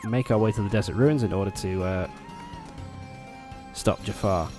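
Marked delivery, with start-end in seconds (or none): none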